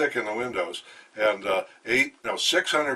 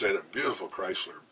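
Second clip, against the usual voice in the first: usual speaking voice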